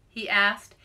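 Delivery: ordinary delivery, nothing marked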